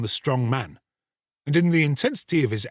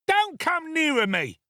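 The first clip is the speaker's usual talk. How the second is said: put-on frightened boy. Boy backs away from murderer.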